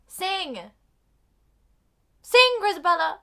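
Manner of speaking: high, loud urging shout; mock urgent command. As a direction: urge her loudly to perform. Character commands another to sing.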